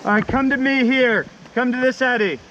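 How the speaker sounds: shouting over rushing water. Real, not acted. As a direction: yell instructions to paddlers downstream. Instructing paddlers across river noise.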